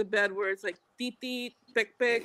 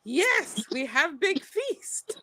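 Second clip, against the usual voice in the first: slow, halting, broken English; imitating the boy; 5 semitones higher, much slower than usual. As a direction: mimic the neighbor kid slowly. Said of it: "He goes".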